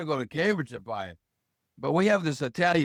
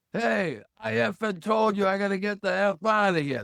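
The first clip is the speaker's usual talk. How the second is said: higher-pitched mock outburst from a priest; retold angry priest; 5 semitones higher than usual. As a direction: snap it like an irate priest who's been blocked in. Priest blows up over blocked car.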